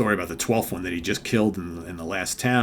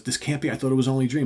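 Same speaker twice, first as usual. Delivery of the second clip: higher, mock-alarmed protest; acted dismayed disbelief; 5 semitones higher, 5 dB louder, faster than usual. Killer finds the trial starting over, acted dismayed disbelief. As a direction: protest as if the nightmare is real.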